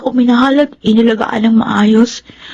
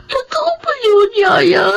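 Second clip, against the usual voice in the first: crying plea; 7 semitones higher than usual. Acted. Child upset about father's affair.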